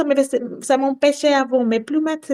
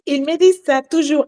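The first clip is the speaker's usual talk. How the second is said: ordinary delivery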